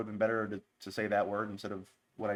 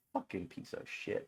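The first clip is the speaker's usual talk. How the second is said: muttered aside; 4 semitones lower, 9 dB quieter than usual. Real, not acted. Muttered aside after bit.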